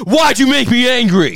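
loud high slow accusing yell; abuser yelling. Retold or imitated. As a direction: scream the blame back. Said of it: "blames the abusee".

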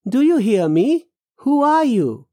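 high, pressing questions; insistent questioning. Acted. Man presses a woman who ignores him, insistent. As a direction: press for an answer, pitched right up.

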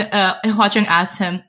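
ordinary delivery

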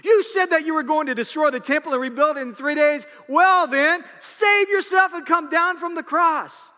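mocking shout. Passersby taunting the crucified Jesus, loud mocking shout. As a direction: taunt him to save himself. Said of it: "they yelled out".

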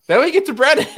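high, loud, breaking into laughter; real laughter. Real, not acted. Host announcing co-host's awful picks, cracking up laughing.

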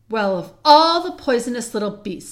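loud, disgusted rebuke; indignant. Acted. Peter rebukes Edmund for lying about Lucy, indignant. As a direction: burst out in outrage.

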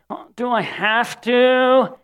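high, drawn-out whine; whiny reluctance. Retold or imitated. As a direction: whine it like a sulky kid. Child whining to mom.

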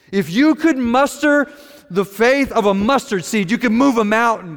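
loud, high, emphatic acted chiding; exasperated rebuke. Jesus telling disciples what faith could do, exasperated rebuke. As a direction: push it out like a frustrated teacher.

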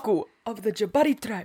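lightly put-on Wakandan accent, fast; bad Wakandan accent. Recalling the old review, a quick mock Wakandan accent. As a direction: rattle off the tribe name in a mock accent. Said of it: "a terrible accent".

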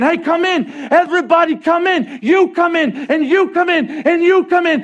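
high, shouted invitation; acted rallying cry; urgent calling out. Jesus calling people into bunker.

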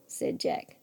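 plain reading voice

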